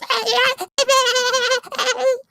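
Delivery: excited high squeaky creature noise; eager hungry little creature squeal. Shown as a demonstration. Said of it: "he starts getting excited and he goes".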